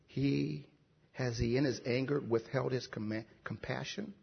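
very hushed, low questions with long pauses; humble questioning. Retold or imitated. Preacher reading the psalmist's questions to God, hushed and humble. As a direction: let each question fade almost to nothing. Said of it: "I think he asked like that".

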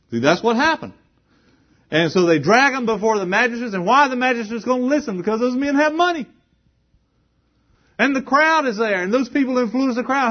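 high, loud, rising preacher's cry; fiery pulpit delivery; full-force preaching. Acted. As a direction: preach it hard to the crowd. Preaches about greed at full force.

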